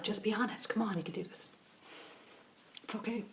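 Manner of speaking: soft whispered encouragement; coaxing whisper. Real, not acted. Questioner whispering softly to coax an honest answer. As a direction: gently coax them to open up.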